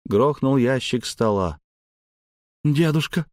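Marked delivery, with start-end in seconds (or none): 2.6-3.3 higher, shrieking call; squeal. Acted. Granddaughter squeals as grandfather grabs a gun, higher. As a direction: squeal in alarm. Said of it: "взвизгнула Шелли".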